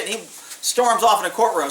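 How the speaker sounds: usual speaking voice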